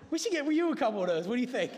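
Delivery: teasing through a laugh, quick; laughing tease. Real, not acted. Preacher jokes to a congregant, laughing. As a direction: chuckle as you offer it.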